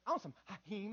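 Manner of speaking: "Hakeem" said for "ice cream"; child's mispronunciation. Retold or imitated. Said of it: "'cause that was ice cream".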